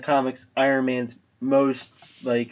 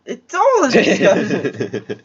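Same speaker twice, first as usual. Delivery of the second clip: laughing; 8 semitones higher, 5 dB louder, slower than usual. Real, not acted. Laughing retort.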